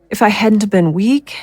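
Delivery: ordinary delivery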